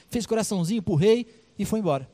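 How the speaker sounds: ordinary delivery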